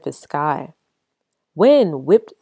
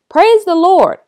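loud, much higher joyful cry; yelled praise; 13 semitones higher, 9 dB louder than usual. Acted. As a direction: cry out to the heavens. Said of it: "My mama yelled".

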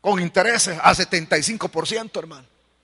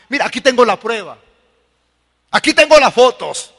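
boastful accuser; 3 semitones higher, 7 dB louder than usual. Acted. Boasting men show their proof.

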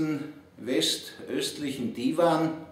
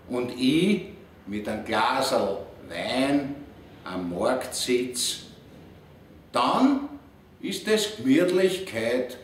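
Viennese dialect. Acted. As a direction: recite it in Viennese dialect, savouring each phrase. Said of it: "auf Wienerisch".

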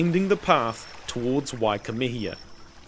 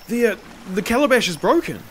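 higher, hesitant, hurried admission; nervous confession; 8 semitones higher, 3 dB louder, faster than usual. A narrator's reading. Slave tells master of breakage, nervous. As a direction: stumble into the bad news.

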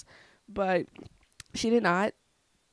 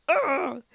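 louder, higher groan of exasperation; frustrated groan; 7 semitones higher, 5 dB louder than usual. Retold or imitated. Venting about grandma on the phone, frustrated groan. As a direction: let out a frustrated "ugh". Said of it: "so frustrating".

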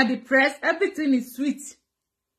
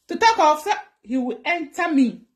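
usual speaking voice

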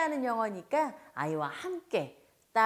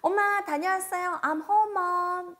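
put-on high, bright child calling home; child back from school calls to mom; cheerful child voice; 9 semitones higher, slower than usual. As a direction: play the kid bursting in the door. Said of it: "아이가 돌아오자마자".

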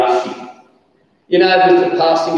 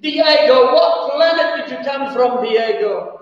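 high, rapturous praise of Diego; overwhelmed commentator; 10 semitones higher than usual. Retold or imitated. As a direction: sing his praises, carried away. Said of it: "he said".

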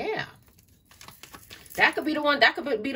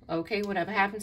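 ordinary delivery

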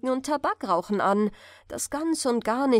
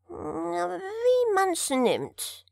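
very slow, higher, crying cat voice; cat's answer; 8 semitones higher, much slower than usual. A narrator's reading. The cat answers the student, slow and mewling. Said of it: "entgegnete der Kater".

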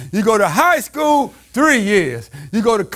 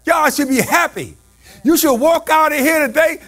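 ordinary delivery

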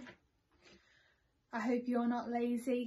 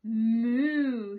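loud drawn-out moo; cow moo; 11 dB louder than usual. A narrator's reading. Cow agrees to the plan with a loud moo. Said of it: "said the cow".

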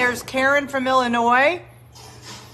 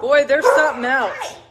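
ordinary talk, nothing marked

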